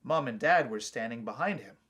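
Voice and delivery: ordinary delivery, nothing marked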